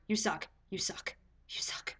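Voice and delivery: fading from low voice to whisper; spent resentment. Retold or imitated. Pranked patient to nurse, running out of breath, resentful whisper. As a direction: let the outrage drain into a soft, breathless grudge.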